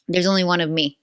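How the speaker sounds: ordinary talk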